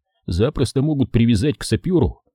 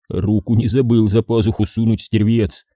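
ordinary delivery, nothing marked

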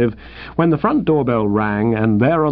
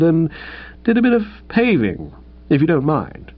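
put-on higher, sheepish young man; lightly raised voice of an awkward young suitor; Tuppy's voice; 5 semitones higher than usual. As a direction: hedge and dither like a lovesick chap. Tuppy asks Bertie a favour.

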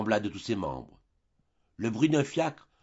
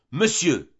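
sharp, indignant cry, higher and louder; Eugène shouting; 5 semitones higher, 6 dB louder than usual. A narrator's reading. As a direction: fling the word out in protest. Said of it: "lui cria Eugène".

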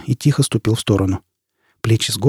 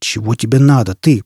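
usual speaking voice

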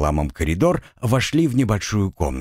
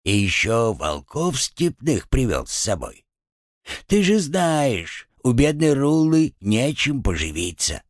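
put-on grumbling old woman; 6 semitones higher than usual. A narrator's reading.

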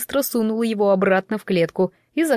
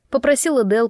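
ordinary delivery

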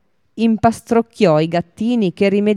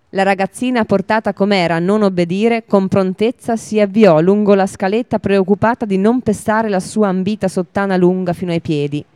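ordinary delivery, nothing marked

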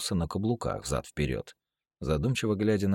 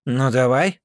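loud dare; 8 semitones higher, 10 dB louder than usual. Acted. Hero dares Shane.

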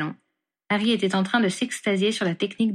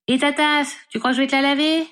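higher, louder call at his back; yelling after him; 7 semitones higher, 4 dB louder than usual. A narrator's reading. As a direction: shout it after someone leaving. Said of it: "hurla Ginny dans son dos". (crier dans son dos)

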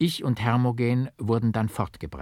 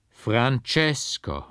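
slow, drawn-out and louder, falling at the end; louder lament; 2 semitones higher, much slower than usual. A narrator's reading. Mother calls the portrait again: louder slow lament. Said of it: "mit dumpfer, tief klagender Stimme".

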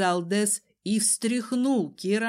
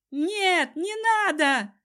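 high, wailing protest; frightened plea; 9 semitones higher, 3 dB louder, slower than usual. A narrator's reading. Cousin recoils from Desmond's threat, pleading in fear. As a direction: cry out in a high, scared voice. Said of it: "Киран испуганно вскочил".

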